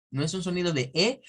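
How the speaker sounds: ordinary delivery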